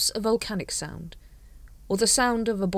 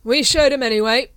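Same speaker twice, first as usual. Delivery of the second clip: put-on loud, excited drunken boast; 7 semitones higher, 10 dB louder than usual. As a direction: crow it out triumphantly. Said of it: "he said excitedly".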